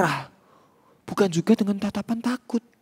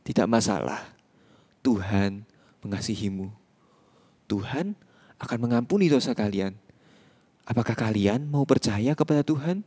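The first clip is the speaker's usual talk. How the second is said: gentle pastor; 7 semitones lower than usual. Acted. Pastor laying hands on crying gang and reassuring them. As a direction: comfort them like a kind shepherd.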